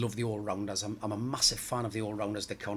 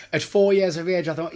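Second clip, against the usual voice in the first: laughing; 8 semitones higher, 13 dB louder than usual. Real, not acted. Laughing after daughter's joke.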